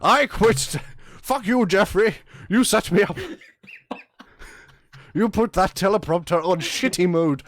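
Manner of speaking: loud, high, hammy outburst; mock rage. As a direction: storm off the news set. Mock-furious anchor quits.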